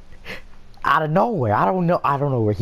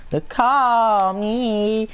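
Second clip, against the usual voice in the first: higher, slow put-on voice; friend's odd voice; 10 semitones higher, 3 dB louder, much slower than usual. Streamer mimicking friend's random call me line, put-on voice. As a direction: stretch call me out in an odd character voice. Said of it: "there's no trigger for this shit".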